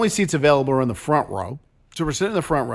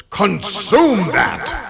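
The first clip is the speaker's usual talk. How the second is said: priest's stern command; 4 semitones higher, much slower than usual. Retold or imitated. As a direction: order the kid to eat it. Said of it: "points at me and goes".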